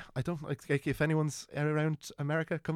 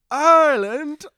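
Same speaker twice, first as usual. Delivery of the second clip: "Ireland?" much higher and louder, rising sharply; delighted surprise; 14 semitones higher, 15 dB louder, slower than usual. Retold or imitated. Firm lads thrilled to hear the singers are Irish, surprised. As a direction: throw "Ireland?" up in amazed delight.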